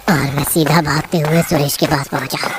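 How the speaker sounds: ordinary delivery, nothing marked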